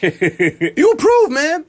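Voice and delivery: drawn-out high good news; slow excited announcement. Retold or imitated. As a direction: stretch it out like a gift. Repeats the customer pitch.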